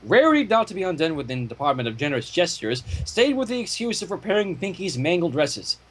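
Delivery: ordinary delivery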